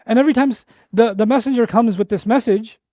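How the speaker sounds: usual speaking voice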